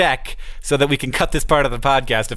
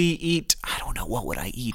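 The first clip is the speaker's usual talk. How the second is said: very soft, low aside; musing to oneself; 3 semitones lower, 8 dB quieter than usual. Real, not acted. American host ponders his own answer, very quiet musing. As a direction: think aloud under your breath. Thinking aloud to himself.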